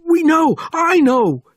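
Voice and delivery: very high, loud screamed protest; panicked scream. A narrator's reading. Sergeant telling Bourne he was killed, panicked screaming.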